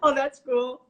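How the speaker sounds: normal talking voice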